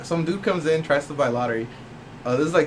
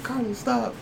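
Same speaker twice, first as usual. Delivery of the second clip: higher, softer imitation of mom; lightly voiced mom stepping in; mom pleading; 7 semitones higher, 7 dB quieter, slower than usual. Mom tells her kid to stop a fight, imitated plea.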